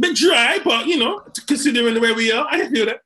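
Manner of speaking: loud, high food-critic verdict; mock food critic. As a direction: judge the dish like a critic.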